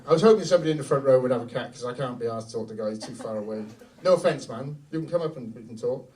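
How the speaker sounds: usual speaking voice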